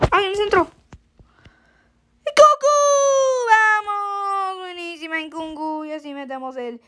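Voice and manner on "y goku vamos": excited cheer. Real, not acted. Goal celebration.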